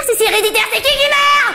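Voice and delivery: panicked escalation. Real, not acted. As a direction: climb into alarm at the punchline. Panicked rising conclusion.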